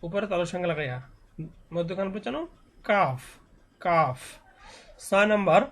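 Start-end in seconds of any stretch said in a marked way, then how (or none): 2.8-4.2 "কাফ" from just in front of the tongue root; touch the palate slightly further forward for "কাফ"; forward tongue articulation. Shown as a demonstration. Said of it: "জিহ্বার গোড়া থেকে একটু আগে বাড়িয়া". (জিহ্বার গোড়ার একটু আগে থেকে "কাফ")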